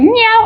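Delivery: high, short cat meow; imitated meow. Describing the vocal cats at the door, high imitated meow. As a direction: mimic the cats meowing at the door. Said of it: "They'll be like".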